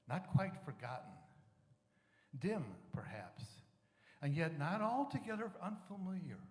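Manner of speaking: loud, fervent reading of holy words, at times dropping to a hush; inspired passage read aloud with rising force; solemn scripture reading. Acted. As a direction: proclaim it like the voice of Jesus, swelling on key lines. Reads Course in Miracles passage.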